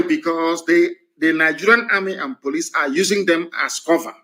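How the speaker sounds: ordinary delivery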